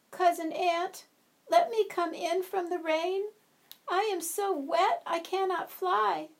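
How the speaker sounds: high, light pleading butterfly voice; a small soaked butterfly begging to come in; wet butterfly. Acted. Wet butterfly begs the ant for shelter, high and pleading.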